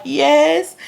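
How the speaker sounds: imitating scared answer. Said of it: "getting so scared".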